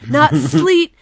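high, very loud wrestling promo shout; Psycho Sid impression. Wrestler shouting at his backstage interviewer, imitated promo rant. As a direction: belt it out like a ranting wrestler. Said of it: "this is my favorite line".

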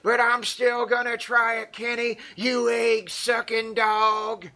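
hammed-up promo, held high throughout; Terry Funk. Shown as a demonstration. Co-host tries an impression on request, high and hammed-up. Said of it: "do a Terry Funk impression".

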